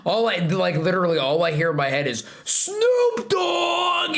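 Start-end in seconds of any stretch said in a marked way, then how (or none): 2.4-4.2 high, drawn-out excited cry; exaggerated high-pitched impression of a friend; Landy impression. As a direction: belt out the name, pitched way up. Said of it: "that's my best Landy impression".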